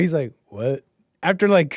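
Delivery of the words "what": calm what. Retold or imitated. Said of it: "in the most calm way".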